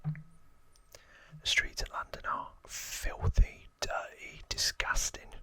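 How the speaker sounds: lightly acted Cockney gangster, whispering; Cockney accent. Gangster reminiscing about childhood with Archie, whispered Cockney accent. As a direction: whisper a put-on Cockney grumble. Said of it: "That's my best Cockney accent".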